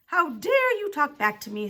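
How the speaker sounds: loud, angry challenge; indignant snap. A narrator's reading. Teacher outraged at a pupil answering, snapping. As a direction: snap it with heavy emphasis. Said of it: "snapped Missus Jules".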